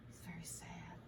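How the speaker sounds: whisper. A narrator's reading. Reading to children, whispering the tadpole is sad. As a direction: whisper it sadly.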